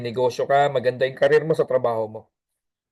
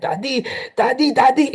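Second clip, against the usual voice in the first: put-on eager kid running in shouting daddy; excited child; 10 semitones higher than usual. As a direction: call out to dad, thrilled. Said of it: "Excited yung bata".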